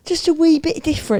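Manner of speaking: high, hammed-up British lilt; mock British accent. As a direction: tease in put-on British accent. Puts on British accent jokingly.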